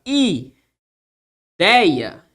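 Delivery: "ideia" drawn out in separate syllables. Shown as a demonstration. Teacher spelling out an example for students, "ideia" syllable by syllable. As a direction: stretch "ideia" slowly, pausing between syllables. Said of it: "A separação se dá dessa forma".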